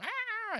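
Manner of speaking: a high shrieking horse cry; horse scream. Retold or imitated. Rider imitates the horse as it is yanked, high shriek. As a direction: squeal like a startled horse. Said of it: "I hear the horse going".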